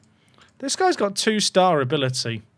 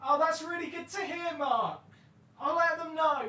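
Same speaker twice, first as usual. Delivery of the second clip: high, much softer, distant dad; a pleased father replying; dad's voice; 10 semitones higher, 12 dB quieter than usual. Pretend. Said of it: "Thanks, Dad".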